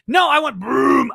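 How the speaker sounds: high, loud shout for the V8; shouted engine boast. Acted. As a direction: yell out the broom like a big engine.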